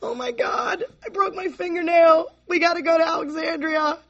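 high mock-panicked whine over a tiny disaster; overdramatic crisis voice. Addict turning every trifle into an ordeal, overdramatic. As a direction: make a broken nail a high-pitched emergency. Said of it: "Everything in active addiction was all about".

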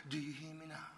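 soft-spoken God asking gently; nice gentle voice. Retold or imitated. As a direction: ask it gently, like God with patience. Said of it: "in a nice voice".

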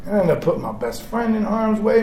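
ordinary talk

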